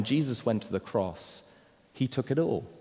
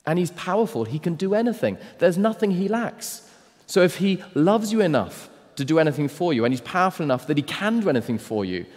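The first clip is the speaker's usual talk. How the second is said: high, loud, driving sermon voice; rising pulpit intensity; fervent preaching; 8 semitones higher, 5 dB louder than usual. Acted. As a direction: preach it with full fire. Pastor preaching climax.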